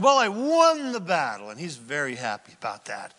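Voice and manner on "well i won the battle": proud Saul. Retold or imitated. Saul proudly reports the victory.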